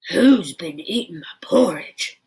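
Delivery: big, loud Daddy Rhino voice; great big roar. Acted. Daddy Rhino roaring at his empty bowl, in a great big voice. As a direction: boom it out like a big papa bear. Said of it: "roared Daddy Rhino in his great big voice".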